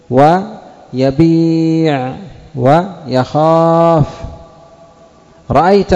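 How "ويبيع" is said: ويبيع said slowly with a long ī; slow drawn-out yabīʿ. Shown as a demonstration. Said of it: "خلاف ما تقدم في القاعدة". (ويبيع ببطء مع مد الياء)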